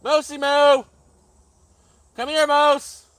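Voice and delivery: high, loud call across the yard. Real, not acted. Owner calling his puppy home, high and loud. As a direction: shout for the dog to come back.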